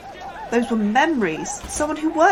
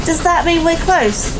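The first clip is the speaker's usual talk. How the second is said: slow, louder, higher question; shouting over noise; 5 semitones higher, 8 dB louder than usual. Acted. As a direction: call the question across the din. Shouting in the hot engine room.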